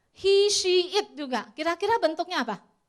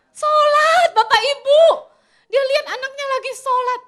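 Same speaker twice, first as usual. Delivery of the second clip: astonished reveal; 11 semitones higher, 15 dB louder than usual. Acted. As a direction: unveil the shock to the whole room. Revealing the surprising twist.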